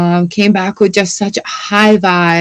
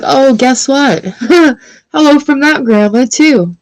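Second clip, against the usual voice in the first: playful voiced message; 6 semitones higher than usual. Voicing a playful spirit message.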